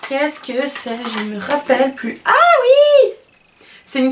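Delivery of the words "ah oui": high, loud burst of recognition; sudden high cry of remembering what the product is; sudden recall. Real, not acted. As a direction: yelp it out as it comes back to you.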